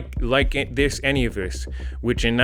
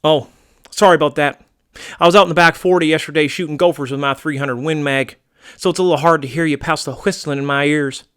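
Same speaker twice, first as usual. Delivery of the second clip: wary, drawling American homeowner; folksy American target; 5 dB louder than usual. Acted. American target recounting an earlier swindle, folksy and wary. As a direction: grumble like a good old boy.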